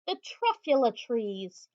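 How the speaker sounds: plain reading voice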